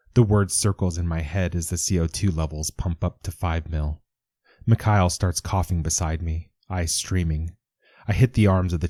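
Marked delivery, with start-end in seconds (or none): none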